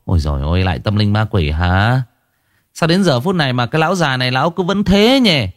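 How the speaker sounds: high, mocking dismissive villager; sneering village woman; scoffing villager. A narrator's reading. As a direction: scoff at the old man's superstition. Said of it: "mấy người bĩu môi".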